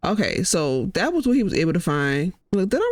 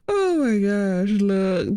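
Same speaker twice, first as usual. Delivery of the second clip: normal talking voice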